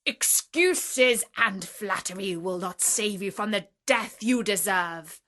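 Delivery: loud, threatening verdict; angry beast pronouncing a sentence; furious beast. Acted. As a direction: condemn the merchant to death. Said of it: "the beast was still furious".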